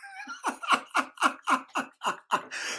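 high, breathy burst of laughter. Real, not acted. Teller laughing at the coach's threat, high laugh. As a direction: let a high laugh break out at the memory. Laughing at his own story.

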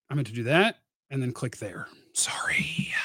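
low whispered self-correction while clicking; whispered aside. Real, not acted. Host fixing his chat window, whispering to himself. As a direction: whisper it under your breath as if off mic.

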